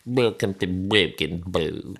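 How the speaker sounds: low, drawn-out welcome; deep, silly stretched announcement; put-on voice. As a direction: ham it up down low. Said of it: "it's not great on the mic".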